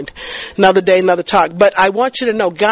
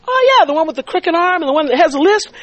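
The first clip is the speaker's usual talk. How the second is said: higher voice of a friend remembering; friend's bright recognition; 6 semitones higher than usual. Retold or imitated. Debbie recalling the man with the crooked arm, higher-pitched recognition. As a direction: lift it high on a sudden recognition.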